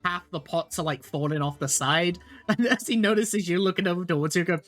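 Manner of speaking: usual speaking voice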